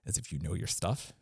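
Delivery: normal talking voice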